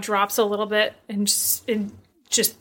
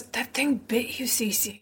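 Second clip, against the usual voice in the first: leaning over to say it much softer; hushed aside; 7 dB quieter than usual. Acted. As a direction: lean in and drop the voice to a hush. June leans over and goes.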